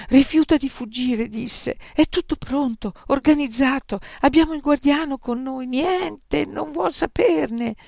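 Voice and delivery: high, urgent, breathless pleading; desperate. Acted. Youth rushing in with bad news, high desperate urgency. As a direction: blurt the news in despair.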